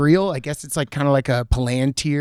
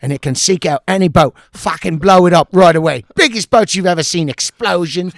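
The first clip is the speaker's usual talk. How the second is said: British braggart; 3 semitones higher, 4 dB louder than usual. Retold or imitated. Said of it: "So he goes".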